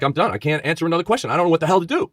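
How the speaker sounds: high-pitched, agitated protest; retold anxious panic. Grilled agent out of answers before the board, anxious. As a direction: replay being at the end of your rope. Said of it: "I'm, like, very anxious".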